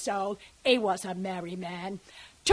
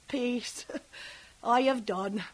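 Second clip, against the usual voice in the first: ordinary talk, nothing marked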